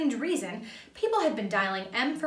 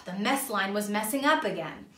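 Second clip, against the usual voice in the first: plain reading voice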